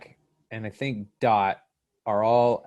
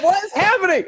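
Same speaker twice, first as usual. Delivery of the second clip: high, loud, laughing disbelief; hammed-up baffled yell; incredulous outburst; 6 dB louder, faster than usual. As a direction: shriek it in mock bewilderment, laughing. Retelling baffled reaction to show.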